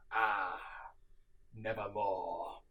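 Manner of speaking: slow, sorrowful; mournful sigh. A narrator's reading. Narrator mourns that she is gone, slow and sorrowful. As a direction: let grief drag it out.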